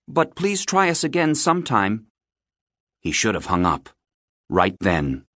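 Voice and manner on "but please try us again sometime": high-pitched, polite phone brush-off; polite boy. A narrator's reading. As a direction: put the caller off politely in a higher voice. Boy politely puts off caller.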